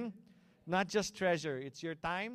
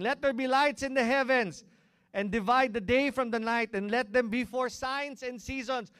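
loud, raised declamatory reading; scripture proclamation; 6 semitones higher, 9 dB louder than usual. Acted. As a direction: proclaim the verse to the whole congregation. Preacher proclaims a scripture verse.

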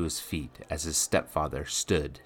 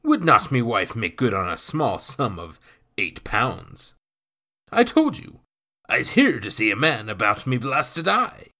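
lightly voiced gruff pirate, a touch louder; rough pirate voice; 6 semitones higher, 8 dB louder than usual. A narrator's reading. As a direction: speak like a swaggering old sea rogue. Narrator voices pirate Nathan.

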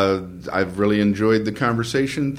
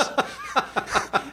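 high breathy laugh; 11 semitones higher, 4 dB quieter than usual. Real, not acted. Laughs at his own story.

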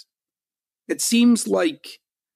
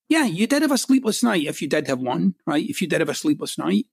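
ordinary delivery

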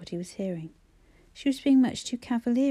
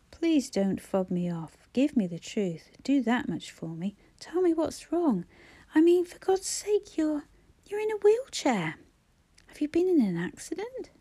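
upset pleading; 6 semitones higher than usual. Acted. Brother pleading, growing upset.

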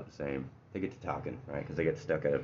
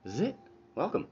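much higher, beaming welcome; proud welcoming host; 11 semitones higher, much faster than usual. Acted. Poor man welcoming the rich man home, beaming. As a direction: show off your home with a smile. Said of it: "he's like".

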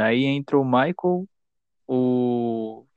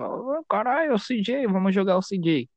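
excited; 6 semitones higher than usual. Real, not acted. Excited recalling playing CJ.

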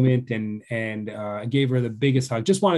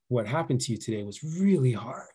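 soft consoling; 6 dB quieter than usual. Retold or imitated. Father consoling daughter.

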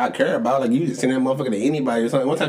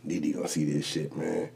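muttered dread; 6 semitones lower, 8 dB quieter than usual. Retold or imitated. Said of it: "I be like".

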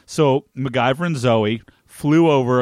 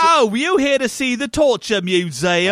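high, hammed-up fake British; mock British tour-guide accent, pitched up; terrible British accent; 9 semitones higher than usual. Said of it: "that's even a terrible accent".

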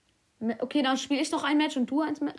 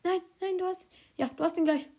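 excited; 7 semitones higher than usual. Real, not acted. Teammate about to get a kill.

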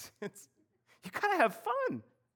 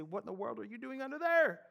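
incredulous question; 4 semitones higher, 3 dB quieter than usual. Retold or imitated. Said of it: "I say".

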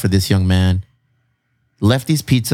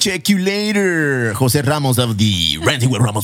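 radio sign-off; slower than usual. Acted. Sign-off announcer style.

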